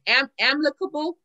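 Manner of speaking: talking around a retainer; wearing a retainer. Real, not acted. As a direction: speak with a retainer in the mouth. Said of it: "I got my retainers in".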